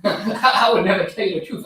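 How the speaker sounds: half laughing, slowed down; laughing through the words. Real, not acted. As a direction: repeat it while cracking up. Echoes friend's line, laughing.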